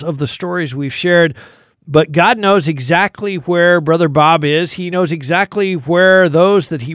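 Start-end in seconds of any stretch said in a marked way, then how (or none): none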